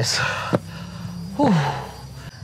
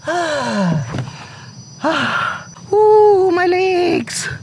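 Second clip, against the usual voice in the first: groaning in pain; 14 semitones higher, much slower than usual. Real, not acted. Vlogger groans at burning legs after the stairs, pained cry. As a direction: groan at aching legs, climbing up into a yelp. Aching legs reaching top.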